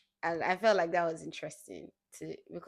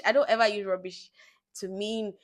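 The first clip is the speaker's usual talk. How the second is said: ordinary delivery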